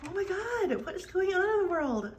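high, amazed exclamation; astonished. Real, not acted. Woman amazed at the squirrel on her screen, astonished. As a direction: exclaim high in real disbelief.

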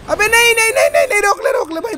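high, urgent string of no's; high-pitched gamer panic; panicked pleading. Real, not acted. About to fall in the game.

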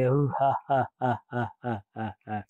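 pulsed ha-has sinking and fading; theatrical monster laugh dying away; demon laugh. A narrator's reading. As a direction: let the villain laugh trail downward. Demon's laugh closes his chant.

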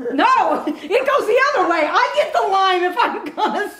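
high, giggly protest; laughing. Real, not acted. Laughing over who gets the lime.

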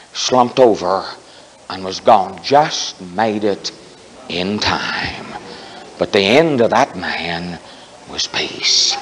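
low, slow, soft reverent close; quiet solemn preacher; hushed and solemn. Acted. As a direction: let it sink to a hush.

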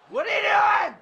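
yelling at the screen in disbelief; loud, exasperated yell at a teammate; frustrated shout. Real, not acted. Furious at teammate in game.

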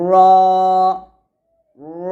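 র rolled with a thick, long-held aw vowel; two sustained demos of a thick র; heavy Ra. Shown as a demonstration. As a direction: stretch each র heavily, lips not rounded. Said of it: "মোটা করে র বলতে হবে".

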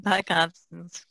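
ordinary talk

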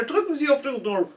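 lightly imitated Indian accent in German; a touch of an Indian-accented German phone agent. Hotline agent giving instructions, light Indian accent. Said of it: "ein kleiner Inder".

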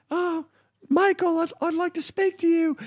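very high falsetto woman; prissy mock plea to her man; girlfriend's voice. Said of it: "the girl's like".

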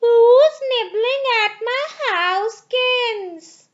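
put-on high, loud and slow old witch; sing-song it like a greedy old witch; shrill witch voice. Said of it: "a shrill voice struck their ears".

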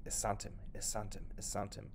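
whispered under the breath; soft whisper. Acted. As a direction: repeat it to yourself so no one hears. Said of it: "he said the word to himself softly".

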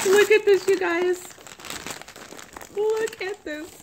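high, excited squeal; thrilled, impatient high voice; giddy anticipation. Real, not acted. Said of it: "Oh, I can't wait".

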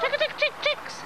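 high chick-chick call; calling chickens. Real, not acted. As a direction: coax the hens with a high call.

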